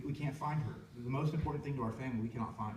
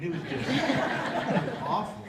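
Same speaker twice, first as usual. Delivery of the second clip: pained laugh; 5 semitones higher, 7 dB louder, slower than usual. Real, not acted. Recalling the awful scare.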